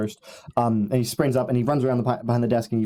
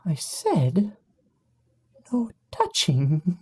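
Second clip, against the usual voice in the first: higher, very slow, drawn-out close-up menace; close and menacing; 6 semitones higher, much slower than usual. Acted. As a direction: drag it out right in their face. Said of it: "he just goes nice and close".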